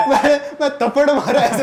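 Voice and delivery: bursts into a loud, high laugh, then talks through it; loud laughter. Real, not acted. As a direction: laughs hard mid-story. Laughing at the kissing story. (ठहाका लगाकर हँसते हुए)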